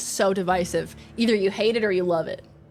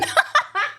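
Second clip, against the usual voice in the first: high giggle. Real, not acted. Laughing at own apology.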